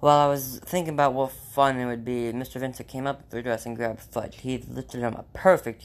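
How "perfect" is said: a loud, higher "Perfect"; excited cry. A narrator's reading. Company president thrilled to find Fudge, crying out. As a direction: burst out with delight at the find. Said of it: "he cried".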